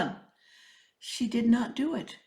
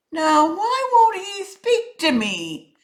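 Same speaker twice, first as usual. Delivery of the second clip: higher, slow puzzled snake voice; python to himself; 11 semitones higher, 7 dB louder, slower than usual. A narrator's reading. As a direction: wonder aloud, slowly, like a worried snake. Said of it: "said the python to himself".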